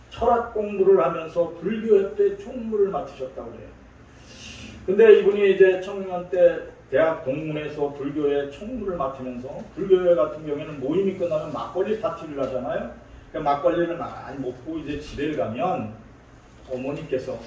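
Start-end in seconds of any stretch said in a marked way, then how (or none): none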